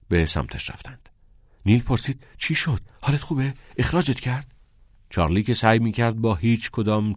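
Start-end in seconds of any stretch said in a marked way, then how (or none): 2.4-4.5 slightly worried, higher-pitched questions; anxious questioning. A narrator's reading. Friend asking the returning pupil, mildly anxious. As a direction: ask with mild urgency, pitch up.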